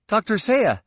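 high, excited interruption; eager greeting. A narrator's reading. Ben cuts in to greet Doctor Seiya, excited and high. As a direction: call out eagerly, pitch way up.

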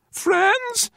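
very high, tiny creature voice; little creature. A narrator's reading. Brown creature echoing the man's word, tiny high voice. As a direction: echo it in a small high pipe. Small creature echoes the man.

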